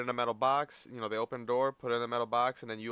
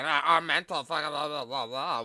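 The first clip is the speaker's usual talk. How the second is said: mocking whine; 2 semitones higher, 5 dB louder than usual. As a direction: sneer out a hammed-up complaint. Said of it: "don't talk about fucking".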